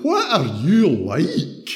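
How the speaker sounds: slow, drawn-out amused exclaim at a funny story. Pretend. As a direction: savour each word with delighted surprise. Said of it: "and exclaiming".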